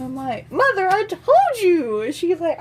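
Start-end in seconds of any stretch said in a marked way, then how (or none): 0.5-2.0 high, loud, drawn-out told-you-so; mock exasperation. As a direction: scold mom in theatrical frustration. Exasperated daughter to mother, retold.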